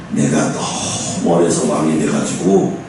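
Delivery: put-on slowly, like a young king unsure of himself; young Solomon's voice. Young Solomon telling God his worry, low and slow.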